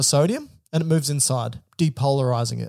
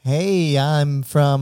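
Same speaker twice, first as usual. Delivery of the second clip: mock Californian accent; much slower than usual. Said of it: "That sounds like Grant".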